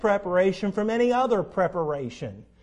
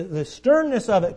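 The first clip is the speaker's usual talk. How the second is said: usual speaking voice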